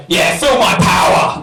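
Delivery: loud drawn-out triumphant shout; hammed-up roar of power; mock power cry. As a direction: shout it like a boastful lover. Acted shout in a bedroom bit.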